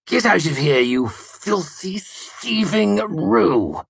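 high, slow venomous insult. A narrator's reading. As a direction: spit the words out. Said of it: "he spat venomously".

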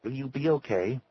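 concerned check on a friend; anxious concern. A narrator's reading. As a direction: ask anxiously, voice lifted. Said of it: "looked into his face anxiously".

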